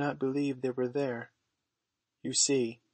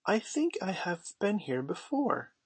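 put-on higher-pitched, unsure little boy; doubtful; 7 semitones higher than usual. A narrator's reading. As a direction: puzzle it out uncertainly, pitched high. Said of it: "looking around him doubtfully".

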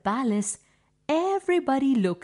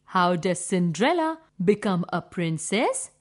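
plain reading voice

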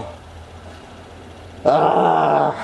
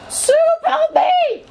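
squeaky cry for help. Pretend. Calling dog for help.

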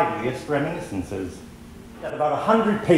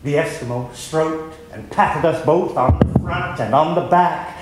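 ordinary talk, nothing marked

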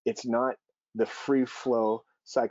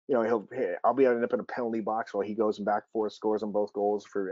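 normal talking voice

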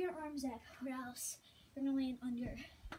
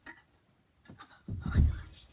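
breathy gasp; 10 dB louder, much slower than usual. Real, not acted. Just fell doing a stand-up.

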